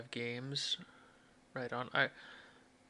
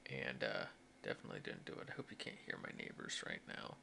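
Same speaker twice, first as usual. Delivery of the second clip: ordinary delivery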